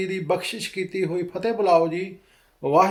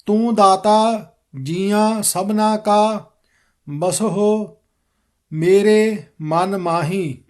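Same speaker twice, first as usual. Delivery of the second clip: slow, measured recitation of scripture; careful model reading; slower than usual. Shown as a demonstration. Teacher modelling the shabad for students, slow careful recitation. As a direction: read it out slowly, every word distinct. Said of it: "ਸ਼ਬਦ ਸ਼ੁੱਧ ਕਰਵਾਵਾਂਗਾ".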